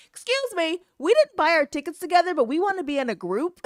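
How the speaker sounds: high-pitched, insistent objection; Christina. Retold or imitated. Imitating a friend objecting to being split up, high and insistent. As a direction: mimic an assertive friend speaking up, pitched high. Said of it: "she channeled her inner Scooter".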